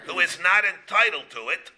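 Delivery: ordinary talk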